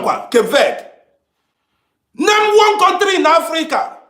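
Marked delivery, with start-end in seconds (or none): 2.1-4.0 raised challenge. Real, not acted. Challenging viewers to name one.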